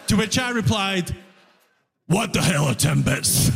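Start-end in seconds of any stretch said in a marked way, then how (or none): 2.1-3.5 low, baffled undertone; retold bewildered question, dropped low; baffled reply.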